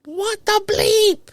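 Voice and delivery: high, loud slow "what the bleep"; stunned panicked outcry; outraged disbelief. Retold or imitated. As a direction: shriek it in horrified disbelief. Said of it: "I'm like".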